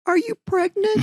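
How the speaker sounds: very high-pitched mock-astonished question; high, rising, comic disbelief; incredulous question. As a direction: imitate a friend's startled guess, voice way up. Friend's startled guess, retold comically.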